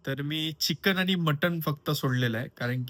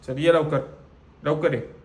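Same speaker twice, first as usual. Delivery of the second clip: calling the dog; 2 semitones higher, 5 dB louder than usual. Real, not acted.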